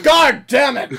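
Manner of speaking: high, loud exasperated curse; exasperated groan. Acted. Whole shed at the stranded engine, exasperated groan. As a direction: groan it out loudly, fed up.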